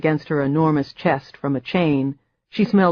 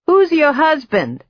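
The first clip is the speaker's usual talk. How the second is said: put-on high, loud old witch cackle; cackling voice; 11 semitones higher, 4 dB louder than usual. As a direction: ask through the door like a witch. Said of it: "the cackling voice asked".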